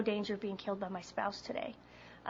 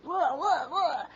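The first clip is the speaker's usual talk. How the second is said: wordless babble, swooping upward; mock blubbering noise; 5 semitones higher, 4 dB louder, much slower than usual. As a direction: spew out exaggerated blahs. Mimics own babbling while panicking.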